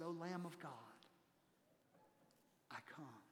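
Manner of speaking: hushed and reverent. Acted. Hymn writer's moment of surrender.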